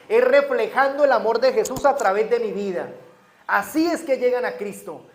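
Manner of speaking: loud, fervent preaching from the pulpit; full-force preaching. Acted. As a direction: drive it home to the congregation.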